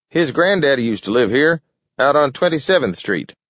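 put-on high old-timer voice; cheeky old man telling news. A narrator's reading.

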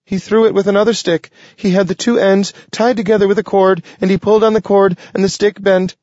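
high, fast squeaky critter chatter; excited squirrel voice. Acted. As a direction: rattle it off like a frantic squirrel.

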